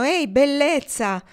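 loud, high, drawn-out shout; yelled catcall. A narrator's reading. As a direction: call out slowly and loudly to a passer-by. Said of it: "Alcuni urlavano".

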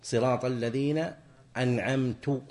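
"أنعمت" ending in -tu instead of -ta; wrong damma on ta. Shown as a demonstration. Teacher shows the error, "أنعمت" with -tu. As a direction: say "أنعمت" as an‘amtu with final u. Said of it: "مثل يضم تاء أنعمت".

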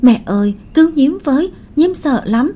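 high-pitched frightened little child, lightly acted; tearful child's plea. A narrator's reading. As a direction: narrator voicing a child pleading for help. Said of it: "liền mếu máo khóc rồi nói".

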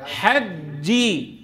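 very slow حجي, sound shut off on the doubled ج; closed strong jeem. Shown as a demonstration. Teacher shows the closure, slow firm ج in حجي. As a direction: close hard on ج in حجي before releasing. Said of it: "شايفين كيف سكرت على الشدة؟".

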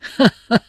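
high, loud burst of laughter; hearty laugh. Acted. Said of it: "cười nói".

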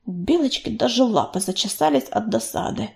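usual speaking voice

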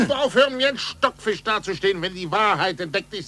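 mock his words back, fired up; triumphant retort; exultant shout.

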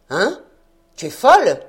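loud, stunned outburst; shocked exclamation. A narrator's reading. Fisherman stunned by his daughter's claim, loud shocked cry. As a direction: blurt it out in disbelief. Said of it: "S'exclama le pêcheur".

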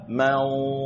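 mansura with a thick, heavy nasal nun; correct mansura. Shown as a demonstration. Teacher models mansura with a heavy nasal nun. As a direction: say mansura with the nun full and dark. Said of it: "Sehingga dibaca".

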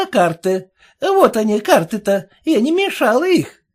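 bow and thank her with biting irony; mock-polite. A narrator's reading. Peddler woman answering the insult with sarcastic politeness. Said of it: "поклонившись, прибавила".

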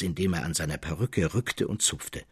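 ordinary delivery

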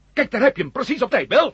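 high, loud triumphant cry; excited. Acted. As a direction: greet the ambush gleefully. Matt sees Bill arrive. (luid en triomfantelijk)

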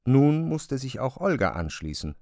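normal talking voice

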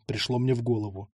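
usual speaking voice

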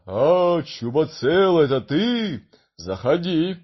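big booming friendly giant; gentle giant voice. Acted. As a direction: boom a hearty welcome. Giant's voice heard at the door.

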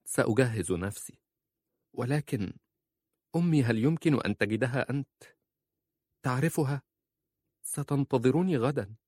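high, subdued appeal; wife hiding tears. A narrator's reading. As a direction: plead while holding back tears. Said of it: "حتى لا يرى دموعها".

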